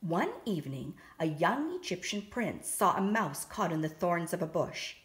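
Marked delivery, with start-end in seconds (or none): none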